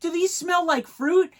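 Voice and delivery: put-on high, slow, curious child's question; loud high kid voice; little girl voice. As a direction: play the wondering little girl. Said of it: "she goes".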